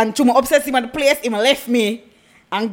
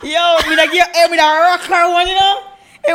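ordinary talk

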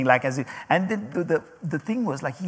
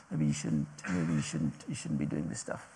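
much lower, much softer mock put-down; condescending discouragement; 13 semitones lower, 11 dB quieter than usual. Critics tell the preacher to quit, in a low, soft, condescending voice. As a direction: imitate a patronising critic, dropping low and soft. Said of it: "they say".

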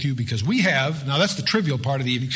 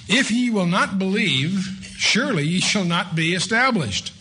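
raised, measured reading of a Bible verse; scripture reading; 7 semitones higher, slower than usual. Acted. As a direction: read it out like solemn scripture.

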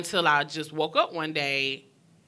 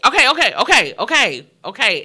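higher and much louder, rapid okays; loud retold urging; 5 semitones higher, 11 dB louder than usual. Brother bursting in, urging the fight to stop, loud. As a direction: shout him down to stop it. Brother rushing in, calming things.

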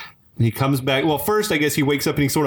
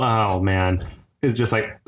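normal talking voice

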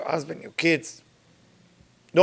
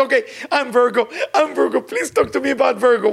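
quick, higher mock-eager pleading; mocking imitation; 6 semitones higher, 3 dB louder, faster than usual. Audience member begs to hear about Virgo, mock-eager. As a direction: imitate someone pestering for a horoscope, hurried and raised.

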